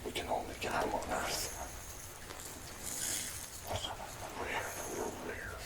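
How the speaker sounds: hushed, breathy secret-sharing whisper; conspiratorial whisper. Acted. Space lord confiding his rare coffee trick to viewers, whispered.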